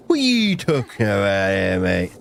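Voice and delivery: gummy, mushy mouth, no teeth; toothless voice. Acted. Streamer asks chat a question, toothless gummy voice. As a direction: talk like you've lost all your teeth. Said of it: "why am I talking like I've got no teeth".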